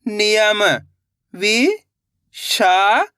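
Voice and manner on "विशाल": very slow, stretched syllables, rising at the end; sounding out syllables. Shown as a demonstration. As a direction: sound it out slowly, letter by letter. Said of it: "एक एक अक्षर का उच्चारण करके".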